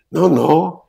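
a hint of a thin, high voice. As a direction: lightly voice him thin and high. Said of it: "He had a very thin voice, high voice".

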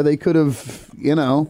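ordinary delivery

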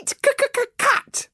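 high stuttering dragon hiss; squeaky dragon hissing, stuttering; stammering little dragon. Acted. Toothless stammering.

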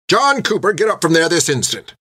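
high clipped order to get up; stern nun command. A narrator's reading. Nun ordering the boy out of the dirt, sharp order. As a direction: snap the boy's name then command. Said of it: "she said".

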